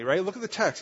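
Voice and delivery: normal talking voice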